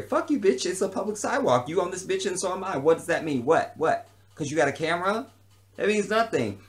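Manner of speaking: high, loud angry outburst; shouting down a film crew; retold heated yelling at the crew; angry retort.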